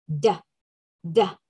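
low, isolated "da"; short voiced "da" for the -ed ending; voiced d sound. Shown as a demonstration. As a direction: model "da" as a bare sound. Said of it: "¿cómo va a sonar?".

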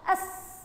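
أس as one joined syllable ending on a held hiss; snatch the alef straight into the seen: أس. Shown as a demonstration. Teacher showing pupils a silent letter, أس snatched as one syllable. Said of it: "أخطفهم مع بعض".

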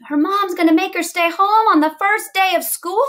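high, incredulous question; hammed-up astonishment; shocked disbelief. Shown as a demonstration. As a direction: react aloud in acted dismay, pitched high. Teacher models a shocked reader's thought.

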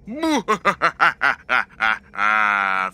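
spooky villain laugh; evil ghost laugh. Pretend. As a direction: cackle like a ghost. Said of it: "Fear me. I'm an evil ghost".

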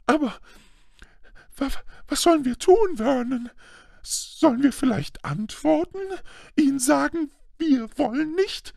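high, stammering anxious woman; trembling voice. A narrator's reading. Aunt asking husband what to do, trembling high. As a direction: plead in a high, frightened voice. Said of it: "mit zitternder Stimme".

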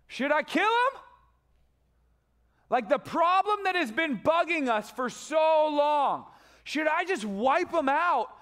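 high, loud, slow and pushy; eager loud question. Acted. The king of Israel asking Elisha, loud and eager. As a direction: demand it, itching to strike.

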